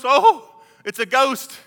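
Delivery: mock fright at seeing a ghost; startled disciples.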